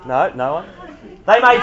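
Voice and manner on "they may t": high, loud film-hero start; mock war cry. Preacher starting a famous film quote for the room, mock war cry. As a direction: launch into a rallying shout.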